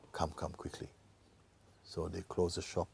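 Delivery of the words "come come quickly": hushed, breathy urgency; urgent whisper. Acted. The doctor whispering to the sons to come quickly. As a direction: whisper it like there's no time left.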